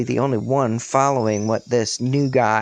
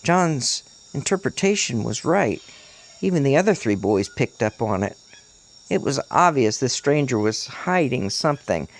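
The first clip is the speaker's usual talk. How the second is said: plain reading voice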